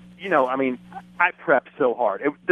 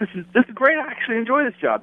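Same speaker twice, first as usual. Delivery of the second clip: mock delight; 9 semitones higher, faster than usual. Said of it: "you'd be like".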